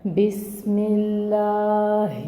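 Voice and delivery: slow melodic tilawat, drawn out; very slow, sung Quranic recitation. Acted. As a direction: chant each word slowly, like a qari reciting.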